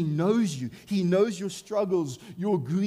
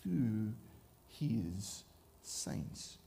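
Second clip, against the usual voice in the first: hushed answer; 7 semitones lower, 10 dB quieter, much slower than usual. Real, not acted. Preacher answering his own question to the congregation, hushed and low. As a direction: drop to a near-hushed, much softer answer after the pause. Dramatic pause before the answer.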